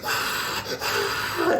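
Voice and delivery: airy, exaggerated gasping scream; mock panicked gasps. Showing how not to act in turbulence, mock panic gasps. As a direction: gasp like a hysterical passenger, played up. Imitates overdramatic screaming passengers.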